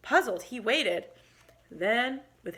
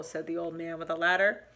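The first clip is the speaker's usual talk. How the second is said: ordinary delivery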